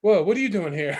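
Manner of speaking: startled at your own home; confused surprise. Retold or imitated. Confused on mushrooms.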